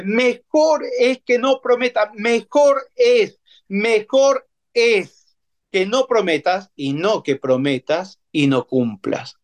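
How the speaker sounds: solemn emphatic verse reading. Acted. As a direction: lean hard on Mejor, slowly. Preacher stresses verse five.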